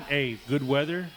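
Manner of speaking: ordinary delivery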